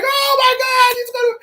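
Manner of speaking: very high mock squeal; excited fan squeal. Students reacting as if to Obama, high excited squeal. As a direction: imitate a starstruck crowd.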